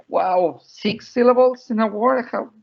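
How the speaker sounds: high-pitched mock disbelief; astonished small child. Mother imitating her little daughter facing a long word, high mock astonishment. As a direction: play a toddler marvelling at a long word. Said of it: "So she was like".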